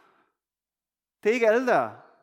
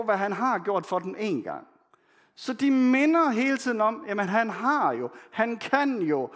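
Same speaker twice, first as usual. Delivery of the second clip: ordinary delivery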